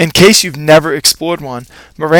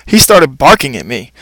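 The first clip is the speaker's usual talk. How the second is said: ordinary delivery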